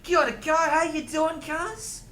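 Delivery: high-pitched mock-Maori accent. Shown as a demonstration. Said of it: "I can do a Maori one".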